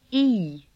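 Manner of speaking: "E" drawn out long; long vowel. Shown as a demonstration. Teacher shows the long version of this sound, long "E". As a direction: hold the vowel in "E". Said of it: "long version of this sound".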